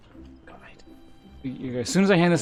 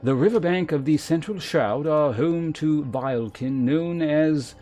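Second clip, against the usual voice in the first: formal, measured mentor with deliberate emphasis; lancer guildmaster; 5 semitones higher, 7 dB louder than usual. Acted. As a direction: instruct a pupil with grave authority. Voices the lancer guildmaster's dialogue again.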